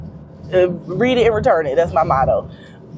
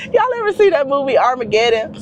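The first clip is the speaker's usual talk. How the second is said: ordinary talk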